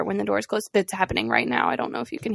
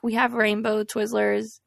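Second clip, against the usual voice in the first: normal talking voice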